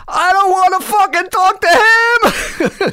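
shrieked, very high and slow, over the phone; furious yelling. Retold or imitated. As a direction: scream it down the phone line, drawn out. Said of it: "this yelling coming out".

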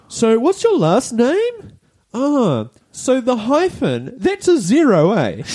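very high, slow airhead imitation; ditzy shop worker. Ditzy clerk taking a customer's last name, high and slow. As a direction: drag it out high, proudly puzzling over the keyboard. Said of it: "the ditzy chick's like".

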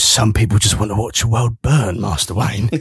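low film-butler impression; Michael Caine. Podcaster quoting the butler in The Dark Knight, Michael Caine impression. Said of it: "when Michael Caine just goes".

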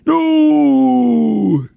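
high, loud cry of "No"; a child's wail of protest; Zoe's cry of no. Retold or imitated. Podcast host voicing Zoe's wail of protest, a loud high cry. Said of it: "says Zoe".

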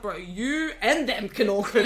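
playful, laughing comeback; laughing retort. Retold or imitated. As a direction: joke it out as laughter breaks in. Joking retort, laughter breaking out.

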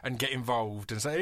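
ordinary talk

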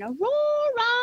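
high, drawn-out calling out; yelled call. Retold or imitated. Grandmother calling out for missing child, high searching call. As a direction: call a name far across the bush, long and high. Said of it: "So I yelled out again".

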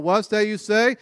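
half-yelled group response; mock camper yell. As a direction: mildly imitate campers yelling back. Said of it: "the campers would yell out".

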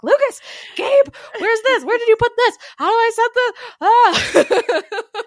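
high, loud mock yelling for the household; frantic calling out. Retelling a morning hunt for misplaced podcasting stuff, frantic mock shouting. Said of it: "running around the house being like".

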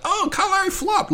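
high, mocking whine of a sore fan; mock the crying fans in a squeaky voice; whiny Celtics fan. Mocking Celtics fans crying about the flop, squeaky whine. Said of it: "Celtics fans are probably crying like".

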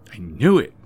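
loud triumphant I knew it; triumphant realization. A narrator's reading. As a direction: crow it over the found photo. Debbie finds the hidden photo.

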